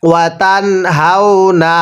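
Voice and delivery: ordinary talk, nothing marked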